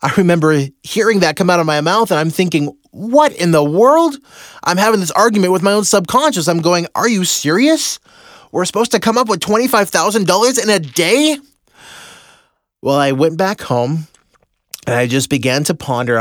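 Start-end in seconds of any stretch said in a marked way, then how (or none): 2.9-4.2 high, slow stunned outburst; shocked disbelief. Retold or imitated. To his own subconscious, a stunned high disbelief. 7.0-11.4 high-pitched disbelieving protest; incredulous. Retold or imitated. Protesting to his own mind, high incredulous voice.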